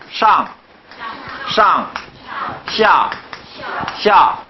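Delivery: teacher modelling Chinese tones for the class; Chinese pronunciation. Shown as a demonstration. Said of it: "중국어".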